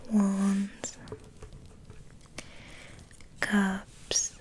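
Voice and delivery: usual speaking voice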